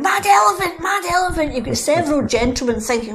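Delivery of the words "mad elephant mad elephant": put-on higher, louder panicked children's cry; kids shouting. Children fleeing the elephant in the station, loud high panicked shouts. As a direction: shout it like frightened kids running about. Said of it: "running about, going".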